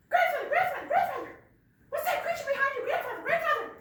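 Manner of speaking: very high, loud squeaky calling; excited little rabbit. Acted. Little rabbit calling to grandfather, high and excited. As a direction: call out like an eager little rabbit.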